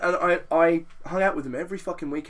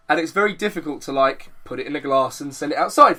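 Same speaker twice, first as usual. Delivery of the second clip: usual speaking voice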